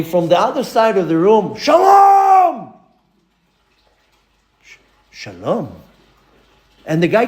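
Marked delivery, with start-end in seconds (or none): 1.6-2.7 high shout across the room; big loud hello; shouted greeting. Retold or imitated. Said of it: "screaming his head off". 4.6-5.7 much softer, lower, stammering back; startled, hushed return greeting; hesitant quiet reply. Retold or imitated. Timid reply to shouted greeting.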